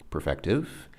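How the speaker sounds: ordinary talk, nothing marked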